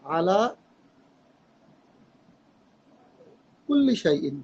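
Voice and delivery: slow, drawn-out verse recital; reading a verse slowly. Shown as a demonstration. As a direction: dictate each word slowly as you write. Verse written and read slowly.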